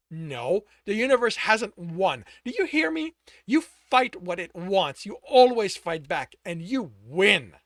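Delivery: loud, slow and emphatic; loud rallying cry to a broken man; fierce insistence. Acted. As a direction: hammer each word home.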